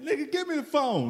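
laughing retelling. Retold or imitated. Laughing retelling of friend's demand.

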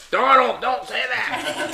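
lightly voiced mother warning her son; mom scolding. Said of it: "my mom's over there like".

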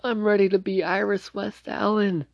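high, teary mock-crying voice; imitating tearful Iris. Iris tearfully tells Barry goodbye, mock-crying high voice. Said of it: "starts crying and is like".

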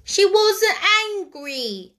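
put-on high, slow small boy's protest; dismayed but firm boy. A narrator's reading. Small boy answering scolding sisters, dismayed but firm. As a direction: hold firm in a little boy's voice. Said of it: "a trifle dismayed, but still firm".

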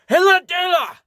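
very high, loud, mashed words; squished shout. Acted. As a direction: yell through squeezed cheeks. Squeezed face, shouting.

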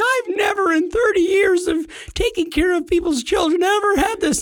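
put-on high, appalled old lady voice; shocked babysitter. Retelling the shocked daycare lady, high and appalled. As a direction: squeak it out like a scandalized sitter. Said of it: "she's like".

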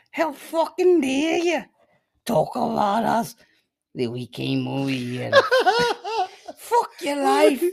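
loud falsetto mock rant; hammed-up squealing outrage, stretched out; aggressive high-pitched accent. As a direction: shriek it slowly in a put-on accent, cracking up laughing. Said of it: "the higher pitched the voice gets".

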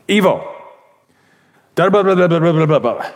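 brusque rapid-fire mock Croatian chatter; cold, curt phone call. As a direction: imitate an abrupt, no-pleasantries caller. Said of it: "that just feels cold to me".

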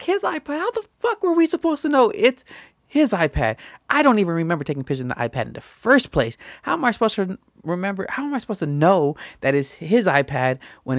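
very high, fast, exasperated defense; mock outrage at an unfair blame; indignant protest. Retelling his indignant reply.

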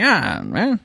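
lightly voiced film noir gumshoe; noir detective voice.

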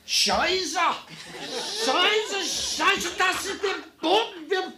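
high, loud German yell; yelling German captain. Retold or imitated. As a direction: shout it in panicked German.

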